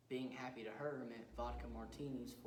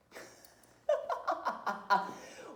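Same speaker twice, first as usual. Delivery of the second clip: loud, high burst of laughter; staccato killer's laugh; maniacal cackle; 12 semitones higher, 10 dB louder than usual. Acted. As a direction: cackle at your own sick joke. Killer laughs at own joke.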